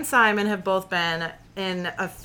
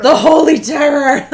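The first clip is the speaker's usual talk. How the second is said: laughing; 8 semitones higher, 8 dB louder than usual. Real, not acted. Laughing at role name.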